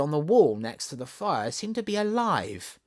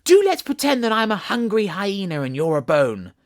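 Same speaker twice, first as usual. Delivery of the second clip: high, loud playful shout in her ear; a girl shouting to frighten her old nurse; sudden shout; 6 semitones higher, 8 dB louder than usual. A narrator's reading. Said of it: "by shouting suddenly in her ear".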